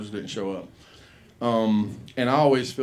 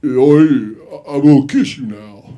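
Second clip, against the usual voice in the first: loud, lumbering Rocky voice; Rocky impression; 12 dB louder, slower than usual. Rocky looming over Adrian, loud lumbering line. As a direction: boom it like a hulking boxer. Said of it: "he's like".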